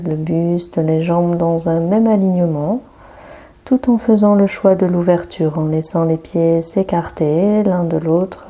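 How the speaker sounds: usual speaking voice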